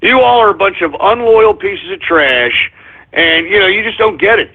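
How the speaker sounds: normal talking voice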